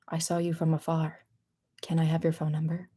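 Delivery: lightly voiced creepy suitor; creepy admirer. Streamer imitating strangers who approach her, mildly creepy. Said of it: "usually in a creepy way".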